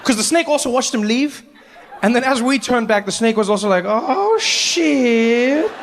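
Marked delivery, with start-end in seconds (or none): 3.8-5.7 high, drawn-out comic dismay; dawning dread. Retold or imitated. Abandoned snake realizing its trouble, high stretched dismay. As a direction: let the horror sink in, stretching it out.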